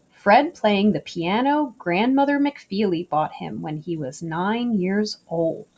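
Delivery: usual speaking voice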